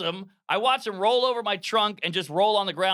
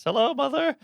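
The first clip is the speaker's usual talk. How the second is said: higher, overly proper hello; fake sober voice; 10 semitones higher than usual. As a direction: try too hard to sound sober. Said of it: "doing my good sober voice".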